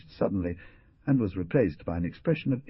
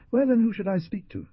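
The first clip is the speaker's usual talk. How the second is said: insolent; 13 semitones higher, 4 dB louder than usual. A narrator's reading. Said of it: "replied Ruperto insolently".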